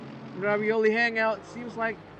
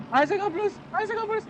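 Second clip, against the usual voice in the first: high, excited repeated yell; calling out the name in a frenzy; excited shouting; 12 semitones higher, faster than usual. Real, not acted. Commentator shouts driver's name repeatedly.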